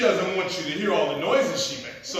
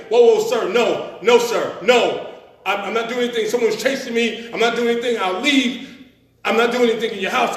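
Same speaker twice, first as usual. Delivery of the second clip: terrified pleading; 4 semitones higher, 8 dB louder than usual. Retold or imitated. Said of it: "'cause I'm terrified".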